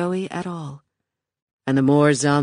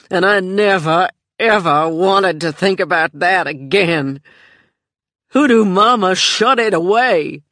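louder, rough and defiant; hard, loud outburst; 3 semitones higher, 7 dB louder than usual. A narrator's reading. Joey tells Michelle she buried it, loud and defiant. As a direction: force the memory shut, loud and hard.

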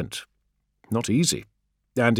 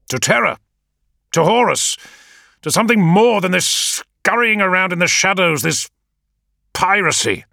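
much higher and louder, impassioned; passionate outburst; 12 semitones higher, 8 dB louder than usual. A narrator's reading. Leader proclaiming his ambition to his adviser, fiercely loud. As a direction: declare it fiercely.

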